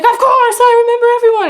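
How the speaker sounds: high, loud mimicked exclamation; over-the-top reassurance. Actor claiming to remember a fan, over-the-top. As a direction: exaggerate it high and loud, playfully insincere.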